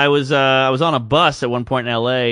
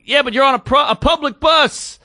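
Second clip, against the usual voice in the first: high, strained comeback, slowing at the end; exasperated argument; 11 semitones higher, 3 dB louder than usual. Retold or imitated. Man arguing back at the girls on the bus, exasperated. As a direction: imitate a man arguing over the noise.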